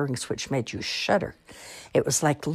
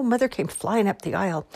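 usual speaking voice

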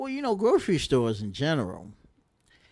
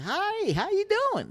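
very high, sing-song greeting; bright, much higher friendly hello; friendly Southerner. Retold or imitated. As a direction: chirp it like a cheery Southern neighbor. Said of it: "and they say".

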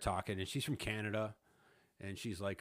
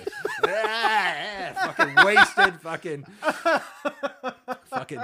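cracking up, much higher and louder; laughing; 14 dB louder, much slower than usual. Real, not acted. Telling the co-host about his drunk friend, laughing hard. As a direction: laugh so hard the words break.

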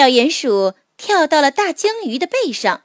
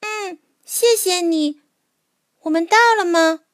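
little mole voice; 8 semitones higher than usual. A narrator's reading. Mole on the way home.

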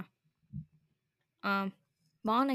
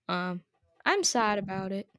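ordinary talk

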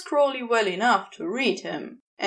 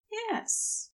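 a soft, higher yes; said more quietly; 12 semitones higher, 7 dB quieter than usual. A narrator's reading. Kneeling angel answers Crowley softly, quiet high yes. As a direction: barely breathe the yes. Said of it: "more quietly".

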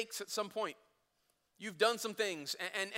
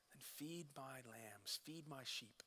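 much softer, lower, slow and deliberate; tender charge; 7 semitones lower, 20 dB quieter, slower than usual. Retold or imitated. Jesus reinstating Peter, slow and tender. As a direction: hand him the mission gently.

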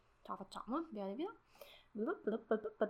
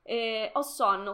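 usual speaking voice

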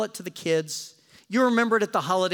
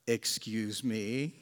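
much lower, softer, slow excuse me; understated excuse me; 10 semitones lower, 9 dB quieter, much slower than usual. Retold or imitated. Risen Jesus casually reappearing, low and slow. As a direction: drop it low and slow like a sly entrance.

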